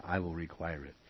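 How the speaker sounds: dropped soft, almost an aside; quiet echo of the verse. Shown as a demonstration. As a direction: say it again quietly, falling. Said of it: "I'll add".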